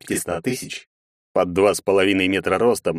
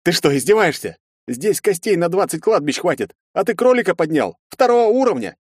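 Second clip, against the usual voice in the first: rising outraged high shout; growing disbelief to yelling; exasperated yelling; 9 semitones higher, 3 dB louder than usual. Acted. Hero berates the major.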